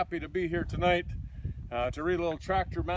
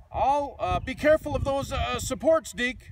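higher, louder hesitant warning call; warning shout; 10 semitones higher, 7 dB louder than usual. Acted. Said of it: "shouted Tractor Mac".

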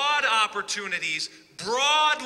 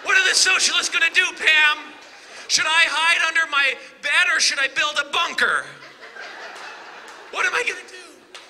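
high, loud mock-terrified pleading; hammed-up fake fright, higher and louder; sarcastic mock panic; 7 semitones higher, 5 dB louder than usual. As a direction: ham up being scared of socialists to ridicule Pam. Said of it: "not to be sarcastic".

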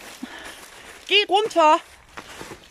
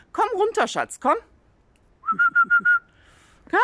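high call up into the tree; 3 dB louder than usual. Real, not acted. Calling hens down from the tree, high coaxing call.

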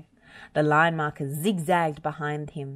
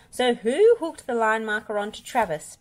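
higher, louder stern demand; 6 semitones higher, 4 dB louder than usual. Acted. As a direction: press him for the truth. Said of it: "Bob demanded".

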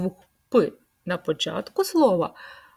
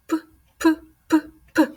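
louder, higher puffed P sounds like a bubbling pot; boiling pot puffs; 9 semitones higher, 6 dB louder, faster than usual. Shown as a demonstration. Teacher repeating for children, loud puffed P like boiling pot. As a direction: pop each P out like a lid rattling on a boiling pot. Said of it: "Повторіть іще раз".

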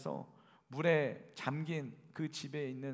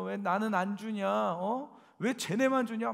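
louder retold yell, slow on the first question; imitating crowd yelling; 6 semitones higher, 6 dB louder, slower than usual. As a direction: shout it back as the crowd banging on the car. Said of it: "막 소리를 지르더라고요".

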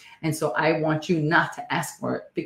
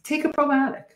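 excited urging; 12 semitones higher than usual. Real, not acted. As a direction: pitch jumps way up on take a probiotic. Urging viewers to take probiotics.

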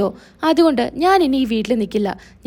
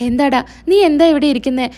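ordinary delivery

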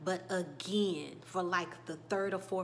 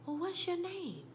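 put-on gentle kid-talk; 11 semitones higher than usual. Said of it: "Moria says".

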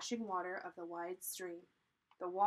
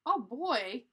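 put-on misread oh boy; 5 semitones higher, 9 dB louder than usual. A narrator's reading. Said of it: "I read that wrong".

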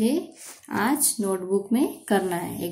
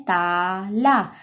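stretched slowly syllable by syllable; slow sounding out; much slower than usual. Shown as a demonstration. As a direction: draw out ताला very slowly. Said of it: "मुंह को ऐसे खोल के बोलेंगे".

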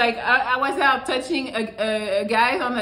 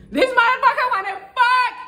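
high, loud mock-shocked outburst; shocked realization; 13 semitones higher, 6 dB louder than usual. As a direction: act out a girl suddenly catching on. Girl realizing his intention.

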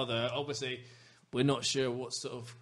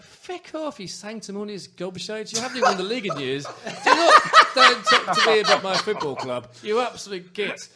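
loud, high mock tirade with laughter; mock rant; 8 semitones higher, 12 dB louder than usual. Telling off smug rival fans on the platform, loud mock rant. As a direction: rant at them, laughing as it builds. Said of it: "and it's like".

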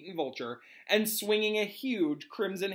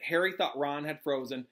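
ordinary delivery

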